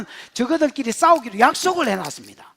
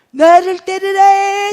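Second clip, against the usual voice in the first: much higher, louder and slow put-on voice; mock foolish leader; 6 semitones higher, 9 dB louder, much slower than usual. Preacher mocking a foolish leader's order, high and slow. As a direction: drag it out like a silly commander.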